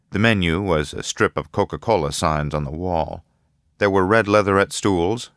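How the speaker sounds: ordinary delivery, nothing marked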